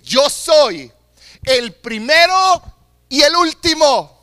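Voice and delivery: loud, rising proclamation; proclaiming. Acted. Preacher voicing Jesus to the congregation, loud proclamation. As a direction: declare it as Jesus would.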